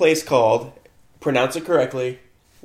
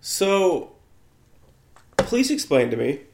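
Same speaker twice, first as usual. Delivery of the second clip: ordinary delivery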